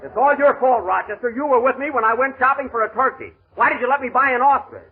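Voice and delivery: pin the blame on him; blaming. Acted. Boss scolding his butler over the ostrich, exasperated.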